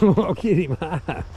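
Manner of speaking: high-pitched giggling through the words; laughing while talking. Real, not acted. Drunk vlogger laughing at his beaver threat, giggling high. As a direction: cackle at your own joke.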